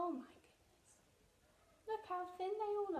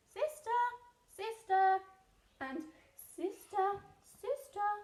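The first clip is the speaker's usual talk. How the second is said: echo; 5 semitones higher, 7 dB louder than usual. Acted. Echo from the cave.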